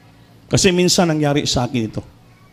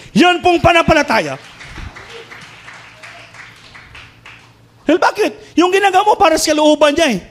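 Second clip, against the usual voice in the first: fiery preaching. Acted. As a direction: preach it with rising fervour to the church. Preaching climax. (masidhing pangangaral)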